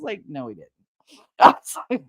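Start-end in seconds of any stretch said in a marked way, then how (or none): 0.3-0.7 low, quiet correction; deadpan denial. Retold or imitated. Walking behind the boaster denying it, low deadpan. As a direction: drop low and soft to contradict him.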